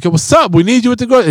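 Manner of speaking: retold impatient summons. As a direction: call the child over, acting impatient. Retelling summoning his busy daughter.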